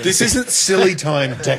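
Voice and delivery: mock stern scolding.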